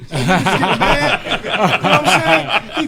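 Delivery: loud laughing telling; real laughter while retelling the game; laughing hard. Real, not acted. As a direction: laugh through the words.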